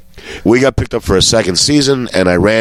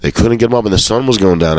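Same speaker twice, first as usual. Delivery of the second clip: ordinary delivery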